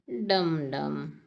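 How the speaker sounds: very slow drum beats, dum dum; slow damru drum. Shown as a demonstration. Teacher repeats the damru sound very slowly for the class. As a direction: drag out each beat. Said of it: "डमरू की ध्वनि क्या होती है?".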